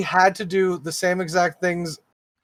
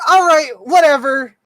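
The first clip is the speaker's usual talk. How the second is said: frustrated outburst; 5 semitones higher than usual. Retold or imitated. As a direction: snap at the agent, fed up. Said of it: "verbally getting more and more frustrated".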